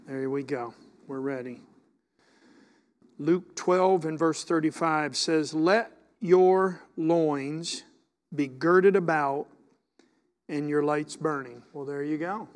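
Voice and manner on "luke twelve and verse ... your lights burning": scripture reading. Acted. Reads scripture aloud.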